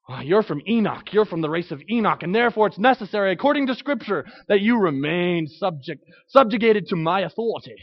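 put-on a light British accent, hinting at a smug slaveholder; lightly voiced British-accented slaveholder, claiming his authority. A preacher mocking a smug slaveholder, in a light British accent. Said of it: "I don't know why I went into British".

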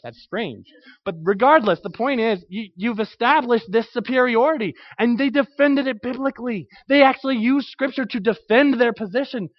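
ordinary talk, nothing marked

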